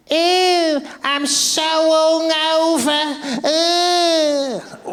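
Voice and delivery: put-on high, whiny hungover girl voice dragging out the ew; bridge troll voice. Stunning woman at the bar, high put-on bridge troll voice. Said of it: "the voice of a fucking bridge troll".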